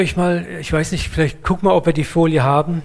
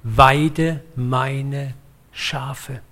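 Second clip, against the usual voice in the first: very slow, each word set apart; feierlich langsam; much slower than usual. Acted. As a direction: let every word stand on its own, solemnly. Solemn turning-point quote of Jesus. (getragen, Wort für Wort)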